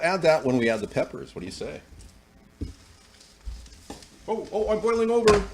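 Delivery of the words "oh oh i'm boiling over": sudden high alarmed "oh, oh"; surprised. Real, not acted. Cook startled when his pot boils over, higher and louder. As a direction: jump up in pitch as the pot spills.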